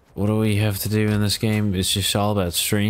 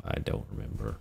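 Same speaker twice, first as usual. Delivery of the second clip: whispered; 11 dB quieter, faster than usual. Pretend. Voicing character, unvoiced.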